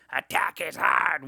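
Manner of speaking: slow, loud villain voice put on; a child's hammy supervillain impersonation; Green Goblin. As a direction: declaim it slowly like a cartoon villain. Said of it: "you'd talk like the Green Goblin".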